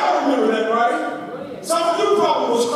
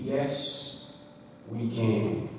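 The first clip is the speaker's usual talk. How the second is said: quiet title; 11 semitones lower, 10 dB quieter, much slower than usual. Shown as a demonstration. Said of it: "tag a title to our text".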